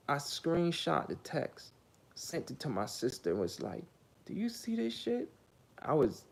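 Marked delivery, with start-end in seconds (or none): 4.2-5.3 incredulous. Retold or imitated. Showing sister the text.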